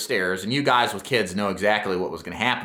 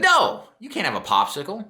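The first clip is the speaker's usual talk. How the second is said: firm, raised-pitch parental refusal; authoritative no; 7 semitones higher than usual. Retold or imitated. Dad refusing his daughter a morning popsicle, firm and authoritative. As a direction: lay down the law to the kid, clear and final. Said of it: "I said it like that ... an air of authoritativeness".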